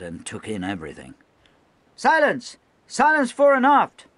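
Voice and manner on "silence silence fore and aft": much higher, loud yell; shrill anxious shout. Acted. Young lieutenant ordering the gun deck silent, shrill shout. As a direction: cry for silence down the whole deck. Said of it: "shrill with anxiety".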